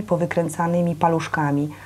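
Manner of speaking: ordinary delivery, nothing marked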